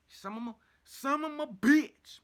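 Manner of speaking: high, loud exasperated yell; all-caps cursing. Acted. Teller rages at the Robo Itch, loud cursing outburst. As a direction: blow up at the itch. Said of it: "I put in all caps".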